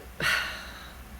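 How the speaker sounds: long resigned exhale; reluctant sigh. Acted. Writer reluctantly agreeing to write a book, sighing. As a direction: sigh as you give in.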